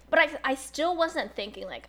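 usual speaking voice

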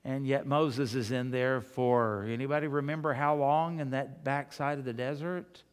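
ordinary delivery

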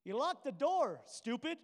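playful call. Retold or imitated. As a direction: bang on the door and tease. Jesus voiced knocking on locked door.

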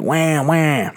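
high-pitched mock baby crying; imitated baby wail. Dad imitating his crying newborn daughter, mock wail. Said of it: "she'd be crying. She'd be like".

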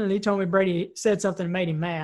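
ordinary delivery

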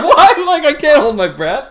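high, loud, laughing disbelief; laughing protest. Retold or imitated. Patient retelling his answer to the scanner operator, laughing protest. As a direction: protest helplessly through laughter, pitch shooting up.

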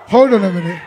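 usual speaking voice

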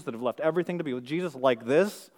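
ordinary talk

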